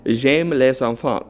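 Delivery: "J'aime les enfants" said slowly in French; French pronunciation. Shown as a demonstration. Host reading the French phrase aloud, slow French. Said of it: "the way to say I love children in French is".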